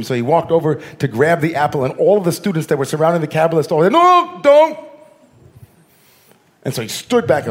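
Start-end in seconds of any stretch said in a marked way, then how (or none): 3.9-4.8 sudden shout, much higher and louder; alarmed shout. Retold or imitated. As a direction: cry out to stop him. Students warn him off apple.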